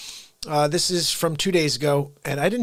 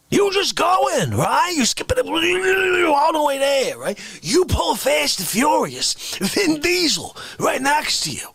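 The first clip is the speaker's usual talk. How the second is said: excited ranting; 7 semitones higher than usual. Pretend. Excited car chase story.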